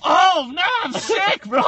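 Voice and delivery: high, loud whiny mock; high loud teasing impression; mocking friend. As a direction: make fun of him being sick. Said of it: "You're like".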